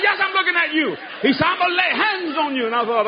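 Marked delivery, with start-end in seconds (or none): 0.0-1.0 lightly mocked preacher, pitched high; imitated preacher. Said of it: "he talked like this". 1.5-2.7 high mock-preacher announcement; imitated preacher. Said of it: "he talked like this".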